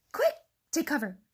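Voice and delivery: a touch of turkey alarm; gobbled warning. A narrator's reading. Turkey warning the birds to hide, gobbling. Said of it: "gobbled Turkey Lurkey".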